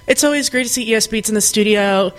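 ordinary talk

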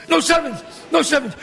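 high, urgent objection; alarmed protest. Acted. Gambler stopping the kid from throwing a seven, alarmed protest. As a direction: cut him off in alarm.

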